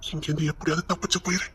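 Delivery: very soft, low-pitched undertone; hushed urging. Acted. Secretly urging the other to escape, hushed. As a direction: urge escape under the breath.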